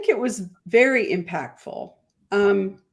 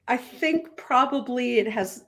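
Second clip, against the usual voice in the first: ordinary delivery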